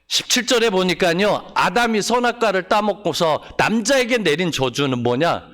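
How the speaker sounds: usual speaking voice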